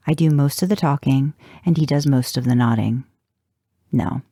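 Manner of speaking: ordinary delivery, nothing marked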